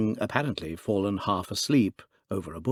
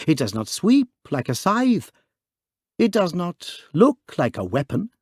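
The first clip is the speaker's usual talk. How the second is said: urgent, insistent; 7 semitones higher, 3 dB louder than usual. A narrator's reading. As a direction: pitch raised, pressing each point. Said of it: "cried Father Brown".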